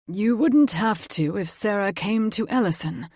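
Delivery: fae father, insistent. A narrator's reading. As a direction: stress on wouldn't, persuasive fae lord.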